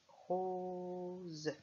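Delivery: Rose drawn out as rooo-ze on a level pitch; syllable by syllable. Shown as a demonstration. Teacher counts syllables for pupils, drawn-out Rose. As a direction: stretch Rose into two slow syllables. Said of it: "On compte les syllabes".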